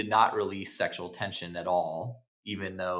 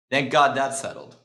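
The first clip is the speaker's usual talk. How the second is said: dominant interruption; 4 semitones higher, 6 dB louder than usual. Retold or imitated. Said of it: "shows a lot of dominance here".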